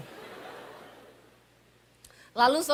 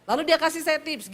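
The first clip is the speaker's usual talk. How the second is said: usual speaking voice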